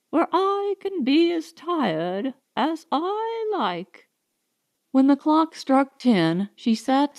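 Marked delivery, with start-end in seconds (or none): 0.1-4.1 inner thought. A narrator's reading. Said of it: "she thought".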